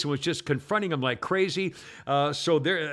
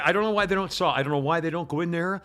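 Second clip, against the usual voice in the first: ordinary delivery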